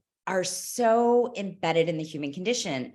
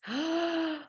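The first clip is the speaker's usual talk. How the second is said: sharp gasp; 10 semitones higher, 6 dB quieter than usual. Real, not acted. Gasp of realization.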